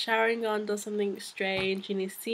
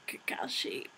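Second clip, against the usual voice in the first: breathy whispered name, no voice; voiceless dying whisper of Kakashi; 3 semitones higher, 10 dB quieter than usual. Retold or imitated. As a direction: whisper his name as she dies. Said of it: "Rin was just like".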